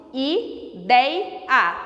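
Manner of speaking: "Ideia" split into i... dei... a; syllable by syllable. Shown as a demonstration. Teacher doing the syllable division of ideia, separated beats. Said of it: "faço a divisão silábica de ideia".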